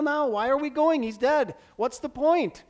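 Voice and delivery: loud, high, exasperated questions; exasperated protest. Acted. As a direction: throw out the disciples' objection loudly. Disciples questioning Jesus' trip.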